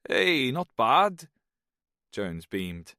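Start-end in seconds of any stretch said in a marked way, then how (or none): 0.1-1.3 slow, loud, higher Welsh reply; easygoing Welsh drawl; Welsh accent. A narrator's reading. Jones answers Snow's greeting in a Welsh accent. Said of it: "The Welshman's accent".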